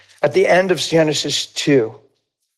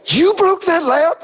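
high, drawn-out mock disbelief; slow, incredulous retold outburst; shocked parent; 13 semitones higher, slower than usual. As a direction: imitate a parent's stunned question. Parents react to late confession.

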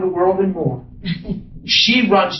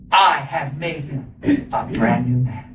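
solemn declaration; 6 semitones lower than usual. Retold or imitated. Preacher quotes God to the congregation, solemn declaration. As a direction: declare it gravely, unhurried.

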